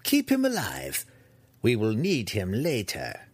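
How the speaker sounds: imperious order easing into explanation; haughty matron giving orders; commanding matriarch. Acted.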